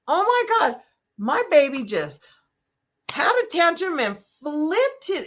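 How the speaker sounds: high, exasperated mom voice; frazzled parent. Retold or imitated. A parent at home whose baby just had a tantrum, high exasperated. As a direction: sound like a tired parent at wit's end. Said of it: "you're like".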